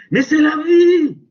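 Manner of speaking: high, loud burst of conviction; exclaiming. Real, not acted. Preacher laughing off his joke to the congregation, a loud high exclamation. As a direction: fling it out as a joyful truth.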